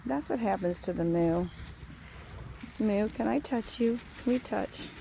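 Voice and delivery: ordinary talk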